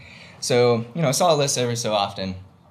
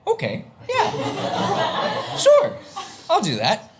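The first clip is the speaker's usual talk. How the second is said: drawn-out high yes; much slower than usual. Retold or imitated. Agreeing to propose at the speedway, in a high drawn-out laughing yes. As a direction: stretch it out, way up high, half laughing. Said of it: "I said".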